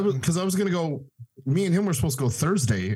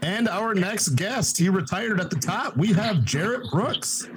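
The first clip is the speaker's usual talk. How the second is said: slow, higher game-show announcer; 7 semitones higher, slower than usual. Pretend. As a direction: introduce him like a big star. Introduces caller like show guest.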